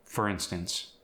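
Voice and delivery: normal talking voice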